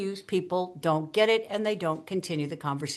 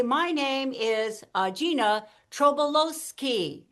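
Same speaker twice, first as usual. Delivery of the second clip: slow, higher, TROBOLOWSKI spelled out by syllable; syllables pronounced; 4 semitones higher, slower than usual. Shown as a demonstration. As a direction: draw out every syllable of TROBOLOWSKI. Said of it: "you actually pronounce the syllables".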